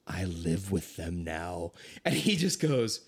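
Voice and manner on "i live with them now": low, softer, slow sheepish admission; sheepish confession. Retold or imitated. Son telling dad on phone he lives with rugby players, low sheepish. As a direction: own up quietly, a bit guilty.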